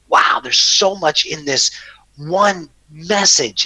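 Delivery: slow, drawn-out amazement; awed wow. Retold or imitated. Recalling the meditation tones, slowly awed. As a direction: let the wonder stretch each word.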